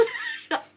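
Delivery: sudden high cackling burst of laughter; loud cackle. Real, not acted. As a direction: let out a shrieking cackle. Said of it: "I cackled so loud".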